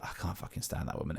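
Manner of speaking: under-the-breath, whispery grumble; muttered contempt. Retold or imitated. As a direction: imitate mum secretly venting. Mum's true feeling once away.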